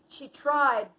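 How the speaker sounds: normal talking voice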